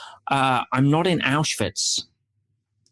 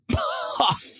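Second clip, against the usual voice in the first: high burst of laughter; 4 dB quieter than usual. Real, not acted. Laughs after the fairy bit.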